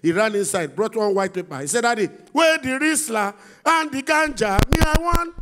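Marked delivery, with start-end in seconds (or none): 1.8-5.3 cheeky son. Acted. Son asks for ganja.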